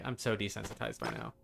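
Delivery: usual speaking voice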